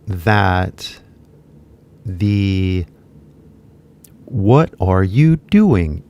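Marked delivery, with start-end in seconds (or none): 4.3-6.0 "What are you doing" said slowly with every word clear; slow full form. Shown as a demonstration. Teacher shows the full form before the reduced one. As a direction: spell out each word slowly. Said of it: "equal to".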